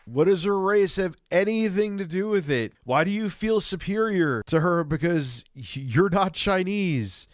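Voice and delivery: real exasperated outrage, pitched high; indignant disbelief. Real, not acted. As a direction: demand an answer, incredulous and worked up. Reacting indignantly to racism in clip.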